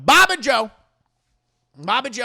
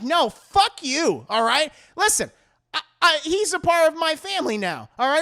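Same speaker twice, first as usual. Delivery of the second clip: loud, higher, emphatic rebuke; indignant telling-off; 6 semitones higher, 4 dB louder than usual. Acted. Leanne defends her family to the Buzzard women, loud and indignant. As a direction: draw out the refusal, then lecture them firmly.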